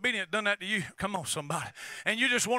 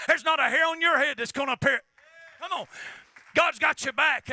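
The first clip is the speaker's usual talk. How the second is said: full-force preaching; 4 semitones higher than usual. Acted. Preacher exhorting congregation about Paul's shipwreck, shouting. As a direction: preach Paul's obedience with fire.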